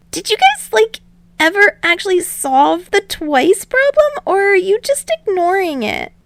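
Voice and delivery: high, loud curious voice; genuinely asking, a bit incredulous; curious teen boy. Acted. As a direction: Izuku asking the league about Twice, high-pitched.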